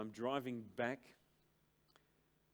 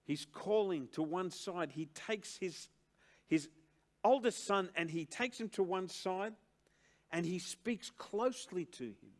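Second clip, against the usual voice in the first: loud, raised, insistent sermon delivery; preaching at full force; 6 semitones higher, 5 dB louder than usual. Acted. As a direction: drive the definition home from the pulpit. Preacher exhorting, slowing for emphasis.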